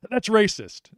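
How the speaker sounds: playful mock indignation; mock outrage. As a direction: fake offence, tongue in cheek. Joking mock outrage at friend.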